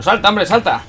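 impatient loud urging. Real, not acted. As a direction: push the character to jump. Urging character to jump.

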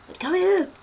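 loud high shout after it; calling out. Real, not acted. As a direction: yell it at a fleeing animal. Chasing an animal, calling out.

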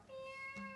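very high soft meow; cat meow. A narrator's reading. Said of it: "sang the cats on the wall".

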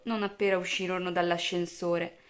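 ordinary delivery

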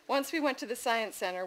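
ordinary delivery, nothing marked

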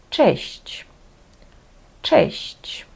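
честь said twice as a model, hard CH first, soft CH last. Shown as a demonstration. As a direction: carefully pronounce Polish честь, hard start, soft ending. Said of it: "Твердий і м'який". (честь: тверде Ч на початку, м'яке ЧЬ в кінці)

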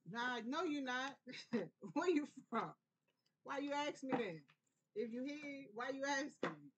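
teasing skeptical stranger. Retold or imitated. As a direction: tease her like you don't buy the answer. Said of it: "people be like".

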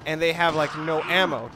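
usual speaking voice

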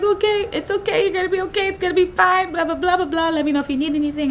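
high-pitched, urgent comforting boss; flipped to reassuring. Retold or imitated. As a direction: imitate a boss rushing to reassure. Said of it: "instantly flipped".

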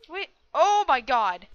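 loud, drawn-out cry of alarm; loud, slow "oh my god"; alarmed outburst. Real, not acted. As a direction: yelp in dismay at a sudden attack. Sudden attack in game.

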